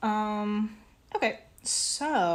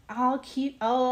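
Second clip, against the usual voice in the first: ordinary talk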